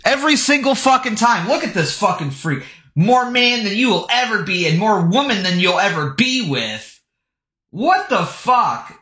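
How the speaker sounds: normal talking voice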